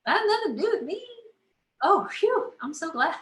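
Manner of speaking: high, fast relieved chatter; high, quick mock relief; relieved inner voice. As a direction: blurt out sudden relief. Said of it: "You know what?".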